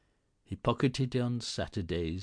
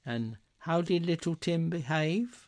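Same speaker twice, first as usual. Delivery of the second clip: mother's voice; 9 semitones higher than usual. A narrator's reading. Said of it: "asked Missus Cratchit".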